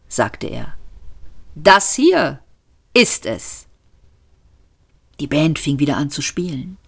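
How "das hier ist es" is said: höher, schwungvoller Conférencier; kündige den Weltuntergang an wie eine Gala; showmaster Max. A narrator's reading. Max moderiert im Restaurant. (Max begrüßt das Publikum als gut gelaunter Showmaster)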